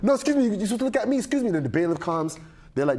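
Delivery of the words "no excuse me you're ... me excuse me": mock prissy scold, pitched higher; indignant magistrate. Magistrate scolding him for looking away, higher mock indignation. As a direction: imitate her huffy correction.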